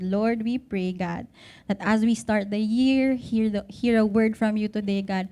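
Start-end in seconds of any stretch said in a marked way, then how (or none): none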